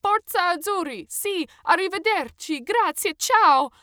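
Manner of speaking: loud, high, slow burst of Italian goodbyes; forced enthusiasm. A narrator's reading. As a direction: overdo the cheer to get away. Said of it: "with as much energy as I could muster".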